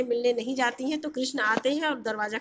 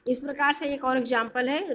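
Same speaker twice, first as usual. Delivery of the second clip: ordinary talk, nothing marked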